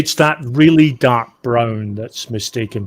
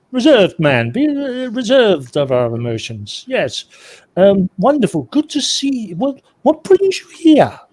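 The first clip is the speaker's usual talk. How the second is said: flustered, higher, stiffly polite greeting; reserved British awkwardness; 9 semitones higher than usual. Acted. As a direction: stammer politely while trying to stay reserved. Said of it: "forgot that I was British. Reserved".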